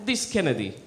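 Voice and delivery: low, soft mock Russian accent; Russian TV watchers judging Kennedy, mock Russian accent. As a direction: grumble it like a Russian viewer. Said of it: "the Russians would have been watching".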